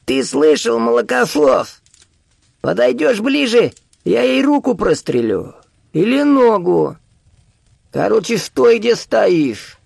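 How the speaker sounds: put-on high, slow old man's threat; drawn-out, high-pitched menace; threatening old man. A narrator's reading. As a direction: threaten him in a strained high voice. Старик угрожает заложницей.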